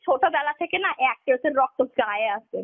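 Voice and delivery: ordinary talk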